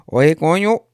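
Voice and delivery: loud, high put-on Caribbean Spanish voice; mock Cuban Spanish accent. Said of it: "That's not my real accent".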